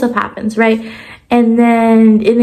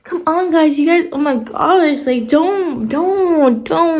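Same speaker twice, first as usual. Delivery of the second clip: high-pitched exasperated disbelief; irritated; 5 semitones higher than usual. Real, not acted. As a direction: scold the characters in sheer disbelief. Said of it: "it really irritates me".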